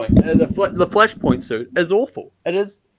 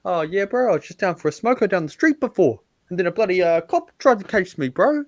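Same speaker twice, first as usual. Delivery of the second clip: New Zealand bloke yarn, climbing higher; exaggerated Kiwi accent; 5 semitones higher, 3 dB louder than usual. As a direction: ham up a Kiwi telling a street tale. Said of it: "an exaggerated version of the Kiwi accent".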